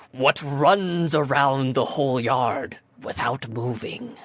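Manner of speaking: slow, measured riddle-giver; solemn sphinx. Acted. Sphinx formally asking players her riddle, slow and solemn. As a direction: pose it slowly and portentously. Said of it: "she kind of straightens her back".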